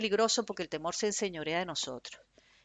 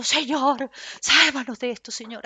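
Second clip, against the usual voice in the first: imitating a frightened plea, louder; mock scared prayer; 4 semitones higher, 8 dB louder, slower than usual. Preacher mocking believers' frightened prayer. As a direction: cry out to the Lord as if terrified. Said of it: "para entonces luego estar asustada orando".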